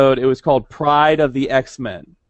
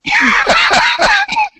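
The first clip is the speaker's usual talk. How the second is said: loud high laugh; 3 dB louder than usual. Real, not acted. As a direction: burst of high-pitched laughter. Laughing at own impression.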